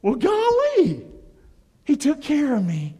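high, slow amazed wonder; grateful believer surprised by provision. Retold or imitated. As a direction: marvel at being provided for. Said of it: "you start to go".

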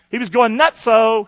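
usual speaking voice

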